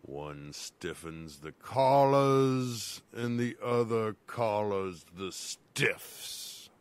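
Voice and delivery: deep slow creepy voice. Acted.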